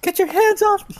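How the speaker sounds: high, frightened woman's cry; distressed woman. Acted. GM voicing Lenin pushing off the ruffian, high and frightened.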